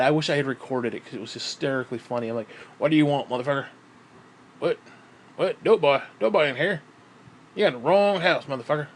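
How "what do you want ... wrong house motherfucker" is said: lightly put-on cursing phone voice; Jim. Telling callers they have the wrong house, mock-hostile as Jim. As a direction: imitate fending off a caller, short bursts. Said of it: "I answered the phone as Jim".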